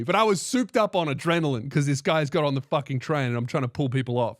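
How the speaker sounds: ordinary delivery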